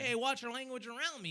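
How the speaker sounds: joker tech guy. Retold or imitated. Tech guy teasing him at the door, jokey. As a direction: imitate a would-be funny guy's high mock warning. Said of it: "he's always trying to be all funny".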